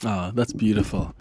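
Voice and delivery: usual speaking voice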